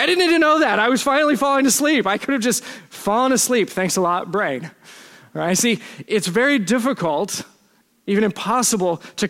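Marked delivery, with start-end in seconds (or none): 0.0-4.7 higher, exasperated protest that winds down into sarcasm; exasperated complaint. Acted. Preacher griping at his own brain for waking him, exasperated. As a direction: start high and aggrieved, sink to a dry finish.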